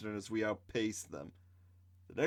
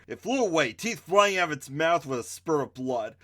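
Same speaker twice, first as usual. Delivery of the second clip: ordinary delivery, nothing marked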